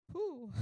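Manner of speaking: a high, shaky whew letting the feeling out; emotional exhale. Real, not acted. Host composing herself after tearing up, high emotional whew. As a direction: blow out a breath to steady yourself. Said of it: "I'm feeling emotional".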